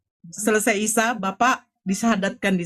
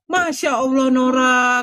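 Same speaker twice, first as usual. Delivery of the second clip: delighted exclamation; 4 semitones higher, 4 dB louder, slower than usual. Retold or imitated. Health centre head hears the news.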